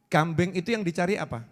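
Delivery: normal talking voice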